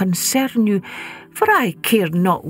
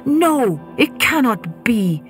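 distressed denial; 5 semitones higher than usual. Acted. Janet refuses the farewell.

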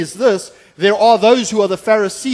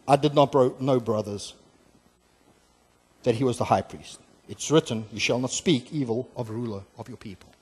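humble admission; 6 semitones lower, 7 dB quieter than usual. Acted. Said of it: "And it says the following".

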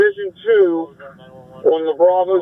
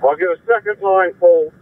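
normal talking voice

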